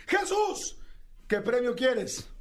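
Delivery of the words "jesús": surprised exclamation. Acted. Host exclaims on contestant's name.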